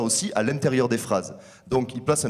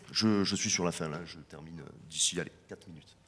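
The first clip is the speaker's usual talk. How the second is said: much lower, fading almost to nothing; hushed aside; 6 semitones lower, 8 dB quieter than usual. Real, not acted. Lecturer noting he is nearly out of time, hushed aside. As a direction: drop to a barely audible undertone.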